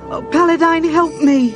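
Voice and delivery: high, loud plea for help; desperate prayer. A narrator's reading. Cleric begs her god for help, crying out desperately. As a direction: cry out to your god in terror. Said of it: "Chrysania prayed".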